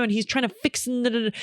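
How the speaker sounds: usual speaking voice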